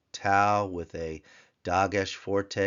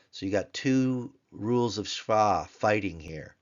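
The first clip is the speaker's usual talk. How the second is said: normal talking voice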